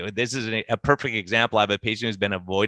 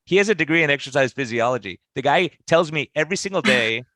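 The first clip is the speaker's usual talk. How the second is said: higher-pitched, incredulous mock complaint; imitated exasperated wife; 4 semitones higher than usual. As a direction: play the wife telling on her husband. Wife exposes husband amid laughter, retold.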